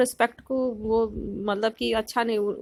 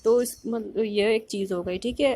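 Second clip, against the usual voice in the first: normal talking voice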